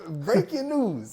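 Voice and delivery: slow, high, loud mock news anchor; mock announcer. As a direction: announce it like breaking news, hammed up. Mock announcement to his friends.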